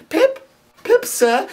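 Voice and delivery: put-on high, small child's voice; frightened child. A narrator's reading. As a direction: blurt it out, very high. Frightened child answers again.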